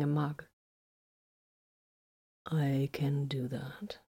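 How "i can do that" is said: slow, hushed whisper; slowly. A narrator's reading. Commander agreeing to terms, slowly and hushed. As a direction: let each word come slow and hushed. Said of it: "he said slowly".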